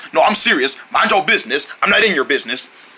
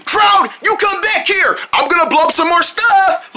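angry shout; 8 semitones higher than usual. Pretend. Drone ordering Crowd to come back, shout. Chasing the villain.

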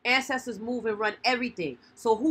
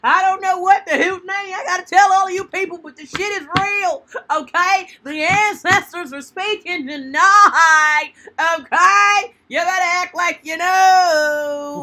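much higher, much louder, slow sermon shout; fiery preaching; 8 semitones higher, 11 dB louder, slower than usual. Acted. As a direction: call down the ancestors on the crowd. Preaches to the audience.

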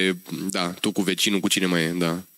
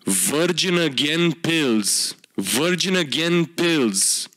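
mock solemn read; much slower than usual. Reading product name slowly.

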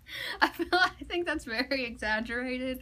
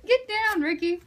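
loud, high-pitched command; calling out; 8 semitones higher, 10 dB louder than usual. Real, not acted. Telling Ricky to get down, real raised-voice call. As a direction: call out sharply over a laugh.

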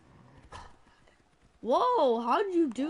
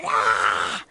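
imitated scream; 8 dB louder than usual. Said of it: "And then she said".

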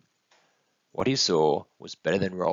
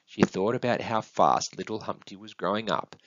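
ordinary delivery

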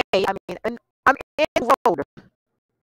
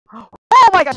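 startled yelp; 8 semitones higher, 8 dB louder, slower than usual. Real, not acted. Crashes into wall.

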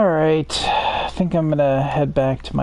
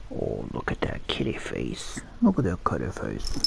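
talking to a kitten; 9 semitones lower, 8 dB quieter than usual. Real, not acted. Cooing at the kitten.

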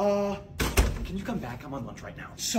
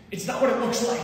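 panicky excuse; 8 semitones higher, 4 dB louder than usual. Acted. Caught by principal, panicking.

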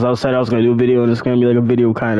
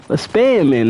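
ordinary talk

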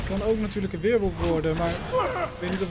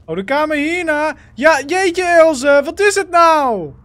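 higher and louder, exasperated; loud exasperation; 5 semitones higher, 8 dB louder than usual. Real, not acted. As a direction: cry out in exasperated disbelief. Frustrated at companion in game.

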